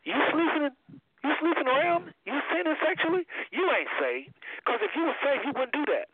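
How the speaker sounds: high-pitched mock scolding; high, imitated accusatory questioning; mock modern preacher. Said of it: "this is how they would say it".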